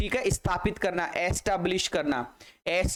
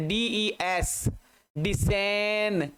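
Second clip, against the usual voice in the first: drawn-out, syllable-by-syllable sounding out; slow syllables; much slower than usual. Shown as a demonstration. Teacher breaking descendant into slow chunks. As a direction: stretch each chunk for the learners. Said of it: "teacher breaking word into parts".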